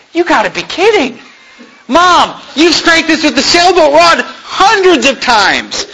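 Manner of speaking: mock protest. Kids protesting to mom, laughing.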